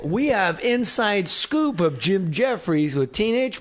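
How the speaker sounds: mock entertainment-show announcer plugging a scoop; Mario Lopez. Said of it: "Mario Lopez at the Grove is gonna go".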